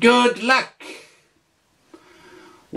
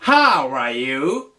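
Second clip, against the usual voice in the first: How are you low, rising at the end; rising question; 4 semitones lower, slower than usual. Shown as a demonstration. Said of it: "tu peux lui dire".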